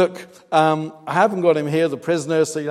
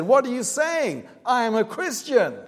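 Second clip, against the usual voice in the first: higher-pitched mock indignation; indignant retort; 9 semitones higher than usual. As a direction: imitate an affronted woman protesting. Retold quarrel, offended woman answering.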